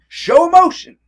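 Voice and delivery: dramatic exclamation; big emotional burst. Shown as a demonstration. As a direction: blow it up with emotion, almost shouting. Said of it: "If they want you to show emotion".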